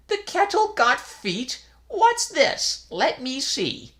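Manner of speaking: put-on high, slow, puzzled old priest; incredulous old priest. A narrator's reading. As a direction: squint in disbelief.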